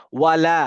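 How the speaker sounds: ওয়ালা with a long drawn লা; reciting the word. Shown as a demonstration. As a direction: say ওয়ালা stretching the লা with alif. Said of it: "এদিকে দেখেন". (শিক্ষকের মতো ওয়ালা উচ্চারণ, লা টেনে)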